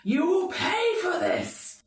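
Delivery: high, drawn-out villain's cry; agonised scream. Acted. Game master voices the wounded demon, agonised cry. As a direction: cry it out in agony, slow and high. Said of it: "He is screaming in agony and he's like".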